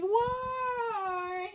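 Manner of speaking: very high, soft mock plea; mock girlfriend whine. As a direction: squeak it out like a pouting girlfriend. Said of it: "So she's like".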